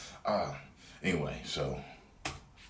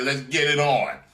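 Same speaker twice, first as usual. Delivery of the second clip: higher, louder fight call; hammed-up challenge; 7 semitones higher, 7 dB louder than usual. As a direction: bark the dare as he stands. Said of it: "He stood right up like".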